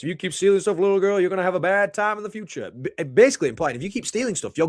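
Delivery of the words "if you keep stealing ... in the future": higher [name]-style wrestler croon; Music Man voice. Retold or imitated. Threatening the chain-stealing girl in Music Man's [name] voice. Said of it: "in a Music Man voice".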